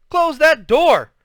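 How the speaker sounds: loud, high, drawn-out family yell; exasperated shouted order. A narrator's reading. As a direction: yell it slowly across the house. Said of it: "and Joey all yelled".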